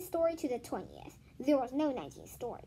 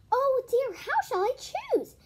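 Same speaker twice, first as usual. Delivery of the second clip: higher, louder teacher voice; fussy teacher; 11 semitones higher, 7 dB louder than usual. Acted. Teacher to her class, flustered and high. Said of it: "asked Missus Jules".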